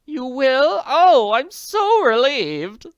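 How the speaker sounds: gushing with relief; relieved society lady. Acted.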